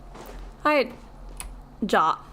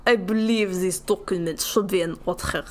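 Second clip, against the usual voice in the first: a shaky, hammed-up Russian accent; fake Russian accent. Shown as a demonstration. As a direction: roll out the line in a cartoon Russian accent. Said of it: "what's a fake Russian ac-, accent?".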